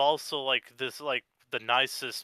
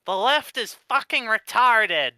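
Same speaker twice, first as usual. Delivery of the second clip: high, slow, nasal sneer; nasal mocking voice; 9 semitones higher, 8 dB louder, slower than usual. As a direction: pinch it through the nose and drag it out. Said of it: "in his, uh, nasally voice".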